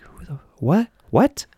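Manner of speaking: high rising bewildered what; startled squeak of confusion; confused. Retold or imitated. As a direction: spin around baffled. Confused reaction retold.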